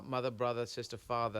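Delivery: MOTHER, BROTHER, SISTER, FATHER ending in a soft vowel, no R; dropped R's. Shown as a demonstration. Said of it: "there's no R".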